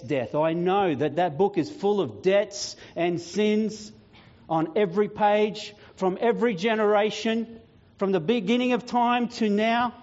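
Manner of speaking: building proclamation. Acted. Jesus concedes the book of sins to Death, building proclamation.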